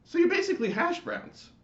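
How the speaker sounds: high, loud, indignant chorus voice; fussy neighborhood lights; annoyed lights. Acted. The lights scolding the screaming latke, high and indignant. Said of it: "said the flashing colored lights".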